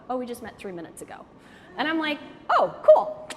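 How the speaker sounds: normal talking voice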